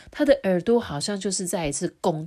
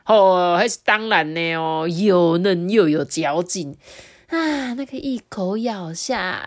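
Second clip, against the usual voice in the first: drooling wolf savouring meat, slowed and louder; hammed-up greedy glee; relishing wolf; 3 semitones higher, 7 dB louder than usual. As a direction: savour every bite in the words. Giro savouring the thought of meat.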